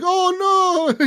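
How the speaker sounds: high squeaky "oh no" of a caught smuggler; mock panic. Caught smuggler whining to the cop, high mock panic. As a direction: whimper it like a busted crook, high up.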